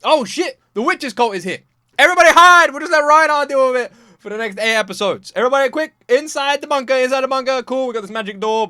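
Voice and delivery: loud, high, panicky shouting; panicked townsfolk. Retold or imitated. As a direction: yell like a scared villager hiding from the cult. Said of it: "if everyone was like".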